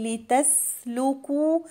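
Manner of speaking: "لتسلكوا" with a long, airy s; whispered-release sin. Shown as a demonstration. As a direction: let breath run through the س of "لتسلكوا". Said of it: "همس السين".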